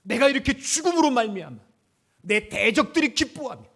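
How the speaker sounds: a loud, fearful David pleading; David's anguished cry. Acted. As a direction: cry it out to God in fear. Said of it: "오늘 다윗이 그거예요".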